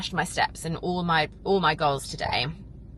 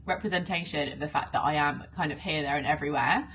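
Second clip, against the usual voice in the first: ordinary talk, nothing marked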